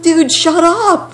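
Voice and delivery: high, loud, slow snap; exasperated snap. Retold or imitated. Mother snapping at the man egging on the attack, high and loud. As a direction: snap at the dude to shut up. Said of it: "I'm like".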